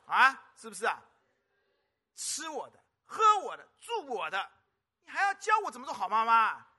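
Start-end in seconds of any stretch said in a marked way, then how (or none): 2.1-6.7 mock-indignant parent listing grievances; mother rebuking her daughter, indignant. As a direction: rattle off what the child owes you. Mother retorting to cheeky child.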